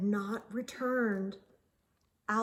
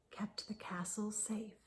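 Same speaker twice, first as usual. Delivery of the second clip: hushed narration; 3 semitones lower, 14 dB quieter than usual. A narrator's reading. Story hush at night.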